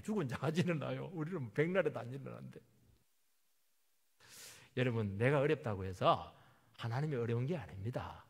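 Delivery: normal talking voice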